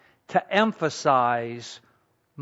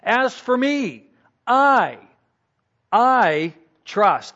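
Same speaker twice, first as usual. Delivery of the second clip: I raised and punched before trust; higher, louder I in I trust; emphasis on I; 6 semitones higher, 4 dB louder, slower than usual. Shown as a demonstration. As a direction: hit the I hard, as for me. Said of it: "put an emphasis on the subject".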